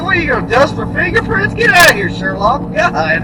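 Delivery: high, loud, fast ridicule; mocking the boss as Sherlock, loud and quick; mocking retort. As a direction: scoff at him like a fake detective. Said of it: "I was like".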